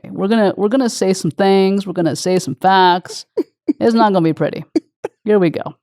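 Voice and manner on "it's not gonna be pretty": usual speaking voice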